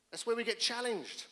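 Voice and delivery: normal talking voice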